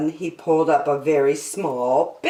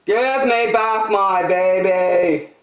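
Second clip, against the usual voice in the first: loud, drawn-out call; yell down a hole; 4 semitones higher, 5 dB louder, slower than usual. Acted. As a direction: holler each word slowly into the hole. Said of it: "yelled down the hole".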